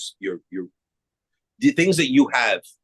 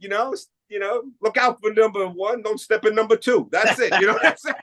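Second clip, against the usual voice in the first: laughing; 10 semitones higher than usual. Real, not acted. Joking about the Nick method.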